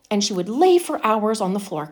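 ordinary delivery

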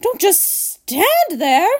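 high, drawn-out scolding cry; exasperated high-pitched order; screaming. A narrator's reading. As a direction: shriek at the husband. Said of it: "Missus Gloop screamed at Mister Gloop".

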